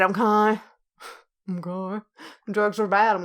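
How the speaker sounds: ordinary talk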